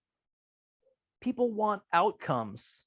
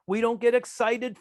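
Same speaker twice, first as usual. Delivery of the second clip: ordinary delivery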